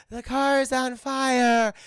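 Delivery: slow, high monotone mock alarm; under-emphasized alarm. Imitating Liv trapped in the burning car, unconvincing slow alarm. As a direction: drag it out like a bad actress. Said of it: "You wanna put a little more emphasis".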